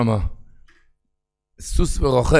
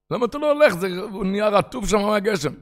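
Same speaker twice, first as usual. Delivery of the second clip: voicing the dialogue; 11 semitones higher, 3 dB louder than usual. Passerby and boy dialogue.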